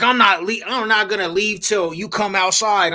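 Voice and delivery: put-on high, insistent girl's voice; girl's pushy voice. Teller imitating the girl outside his mom's house, high and insistent. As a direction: pitch it up like her stubborn demand. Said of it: "She's like".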